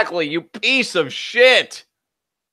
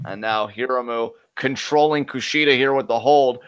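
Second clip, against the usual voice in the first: ordinary talk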